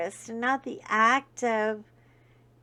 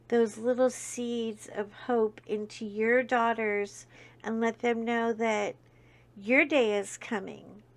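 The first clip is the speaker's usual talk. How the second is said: usual speaking voice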